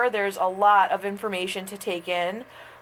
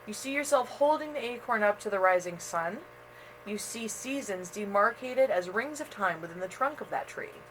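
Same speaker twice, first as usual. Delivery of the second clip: guided meditation; 5 dB quieter than usual. Acted. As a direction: ease the listener into calm. Meditation continues after aside.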